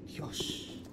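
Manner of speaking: whispered in fear; scared whisper. Real, not acted. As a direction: gasp it under your breath. Creepy moment in horror game.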